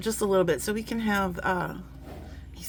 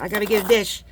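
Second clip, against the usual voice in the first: ordinary talk, nothing marked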